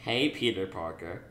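a light teasing, flirtatious greeting; playful teasing. Retold or imitated. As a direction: tease him gently. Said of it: "very playfully like".

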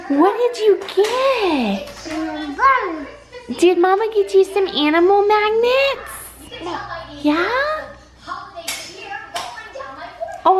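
put-on high sing-song baby talk; reading to a toddler. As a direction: coo to a little one. Talking to toddler about magnets.

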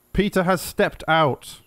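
ordinary talk

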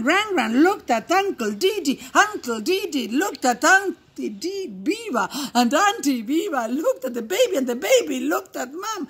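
ordinary delivery, nothing marked

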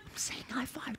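whispered aside. Real, not acted. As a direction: whisper it under your breath. Whispering to a friend.

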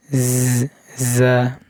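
usual speaking voice

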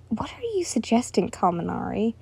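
soft unvoiced whisper. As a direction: lean in and whisper it. Whispered conspiratorial question.

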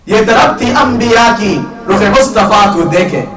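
loud, high, fast Urdu verse recital; impassioned poem recitation. Acted. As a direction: declaim the couplet like a preacher.

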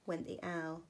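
plain reading voice

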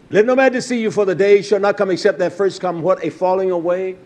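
loud, driving sermon delivery; fiery pulpit preaching; full-force preaching. Acted. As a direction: preach it out to the congregation. Reading scripture from pulpit.

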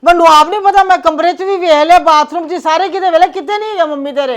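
high, loud frantic explaining; panicked, breathless worry. Acted. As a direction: pour it out in alarm.

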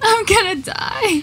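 high, slow cry of doom; panicked wail. Real, not acted. Player without armor facing monsters, panicked wailing.

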